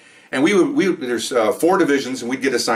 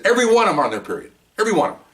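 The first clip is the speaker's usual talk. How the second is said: fast and higher, partner conceding; imitating partner; 10 semitones higher, much faster than usual. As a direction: rattle it off high, as the partner giving up. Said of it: "She said".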